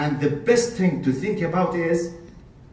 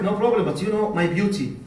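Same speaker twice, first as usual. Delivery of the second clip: usual speaking voice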